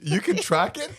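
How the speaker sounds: high, loud incredulous question; astonished. Real, not acted. Husband learns periods can be tracked, astonished. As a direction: ask in disbelief, pitch lifted to the end.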